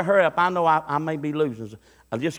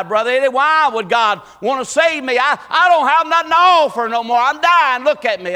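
high, loud cry of a dying man; dying man's plea; 7 semitones higher, 8 dB louder than usual. Retold or imitated. Dying man cries to Brother Eddie, loud and high. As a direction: plead as if dying. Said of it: "He thought he's finna die".

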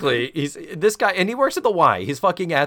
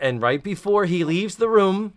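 ordinary talk, nothing marked

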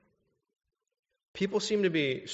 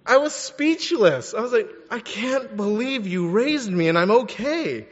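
much higher, louder indignant disbelief; outraged retelling; 8 semitones higher, 8 dB louder than usual. Retold or imitated. Son telling the congregation about mom, higher mock outrage.

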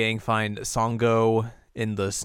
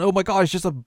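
mock shock; 9 semitones higher, 6 dB louder than usual. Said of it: "he turns around".

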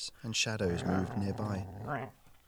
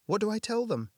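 high, frightened question; 12 semitones higher, 3 dB louder than usual. A narrator's reading. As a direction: ask in panic. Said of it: "Hannah's eyes were wide".

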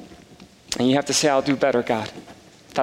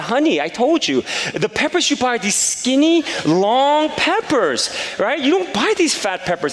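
loud insistence; 7 semitones higher than usual. Retold or imitated. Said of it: "'cause I'm loud".

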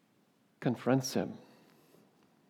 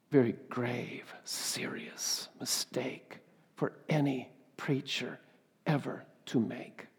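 slow, breathy whispered gravity; soft, slow, hushed solemn caution; grave hushed warning; 5 dB quieter, slower than usual. Acted.

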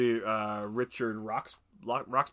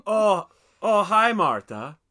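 put-on loud, higher greeting in a thick foreign accent; hammed-up hello with an inscrutable accent; Tommy Wiseau; 8 semitones higher, 11 dB louder, much slower than usual. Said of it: "you famously have Tommy Wiseau".

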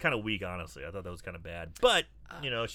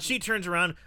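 ordinary delivery

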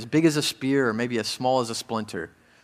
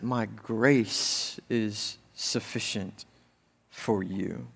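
reverent reading; 3 semitones lower, 5 dB quieter, much slower than usual. Acted. Quoting God's words reverently.

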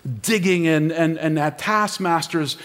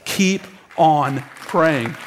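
slow, spaced-out words; slow exhortation; much slower than usual. Real, not acted. Preacher urging church not to give up, slow emphatic exhortation. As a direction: drive each word home separately.

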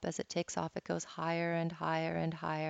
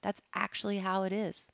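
ordinary talk

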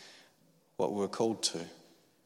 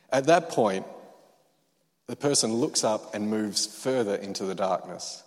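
usual speaking voice

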